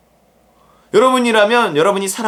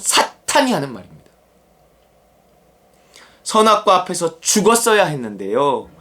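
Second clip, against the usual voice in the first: ordinary talk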